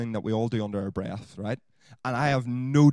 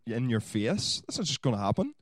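ordinary delivery

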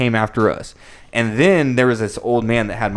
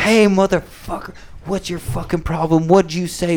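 raised-pitch mock confrontation; coming at you; 5 semitones higher than usual. Ex-quarterback comes at the fans, acted confrontational challenge. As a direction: imitate an aggressor getting in someone's face. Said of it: "he's just coming at us".

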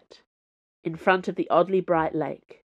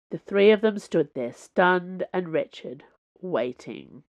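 plain reading voice

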